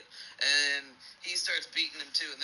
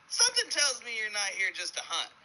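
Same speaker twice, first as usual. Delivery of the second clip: higher-pitched punchline as the bear; knowing bear; 7 semitones higher than usual. Acted. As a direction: voice the bear's knowing suspicion. Bear's punchline in joke.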